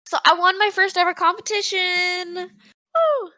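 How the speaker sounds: high, loud cheer of triumph; jubilant, full-voiced bragging; excited announcement. Real, not acted. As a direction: proudly shout the news, ending in a whoop. Announcing a first competition win.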